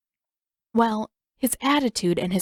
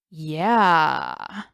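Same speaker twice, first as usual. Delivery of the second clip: drawn-out yeah; 3 semitones lower than usual. A narrator's reading. Sokka teasing Katara about her excuse, drawn out. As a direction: stretch the yeah out long and rising. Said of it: "dragging the word out far longer than necessary".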